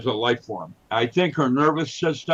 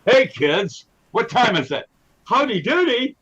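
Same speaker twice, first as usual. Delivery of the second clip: silly clown; 10 semitones higher than usual. Retold or imitated. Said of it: "programmed with Clarabelle".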